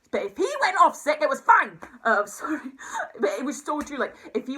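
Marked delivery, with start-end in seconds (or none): 0.1-1.8 much higher and louder, indignant; outraged outburst. Real, not acted. Ranting about her manager, loud high outrage. 2.0-2.6 laughing apology after an outburst; embarrassed laugh. Real, not acted. To viewers after ranting, laughing sorry.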